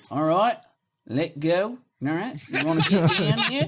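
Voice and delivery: broad mock-Australian coaxing; Australian meditation guide. Mocking guided meditation for listeners, exaggerated Australian accent. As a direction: lead a meditation in thick Australian. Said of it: "you can't listen to in a guided meditation".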